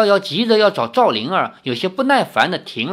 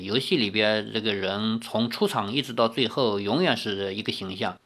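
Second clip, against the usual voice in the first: usual speaking voice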